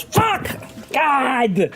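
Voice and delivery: high, drawn-out gnome curse; hammed-up high-pitched exasperation; frustrated gnome outburst. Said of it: "the gnome goes".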